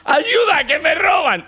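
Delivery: very high, loud shrieked "¡Ayuda, que me roban!"; mock scream for help. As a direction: shriek it like a robbed woman. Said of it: "y grita".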